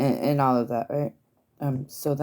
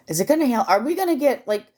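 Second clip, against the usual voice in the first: rising excitement; 10 semitones higher, 4 dB louder than usual. Real, not acted. Excited storm hopes.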